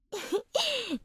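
a short, soft rising giggle; light teasing laugh under the breath. Acted. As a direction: giggle at him playfully. Teasing the boss.

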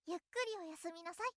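fast, very soft goodnight; soft quick goodnight. Acted. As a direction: whisper it off quickly. Lulling listener to sleep.

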